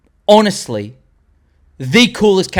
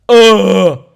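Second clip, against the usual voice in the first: loud, pitched-up ugh of delight; relishing groan; 4 semitones higher, 9 dB louder than usual. Real, not acted. Fan gushes over chrome design, relishing groan.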